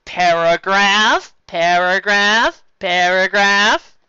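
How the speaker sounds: loud slow drawn-out chant; said loudly. Acted. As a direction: call each word slow and loud. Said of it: "said loudly".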